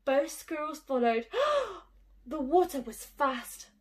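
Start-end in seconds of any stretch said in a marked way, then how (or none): 1.3-1.8 sharp gasp. A narrator's reading. Gasp at the fall.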